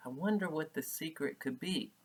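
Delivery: plain reading voice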